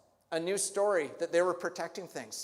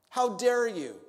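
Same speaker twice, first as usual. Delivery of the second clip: loud, higher, indignant; outraged rebuke; 5 semitones higher, 6 dB louder than usual. Acted. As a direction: bark it in offence. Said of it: "this righteous indignation".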